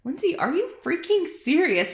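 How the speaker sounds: a hint of an annoyed husband; husband's exasperation. Woman quoting her husband's disbelief, lightly mocking. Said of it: "Sometimes Tim will be like".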